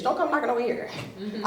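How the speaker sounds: ordinary talk, nothing marked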